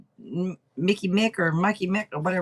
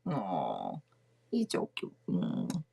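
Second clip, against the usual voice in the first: whispered, so as not to wake him; cooing whisper; 13 semitones lower, 8 dB quieter, slower than usual. Real, not acted. Whispering over the kitten asleep in her lap. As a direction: breathe it out softly over the kitten.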